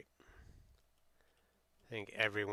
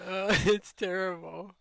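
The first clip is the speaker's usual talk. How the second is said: laughing; 7 semitones higher, 7 dB louder than usual. Real, not acted. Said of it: "he's still laughing about it".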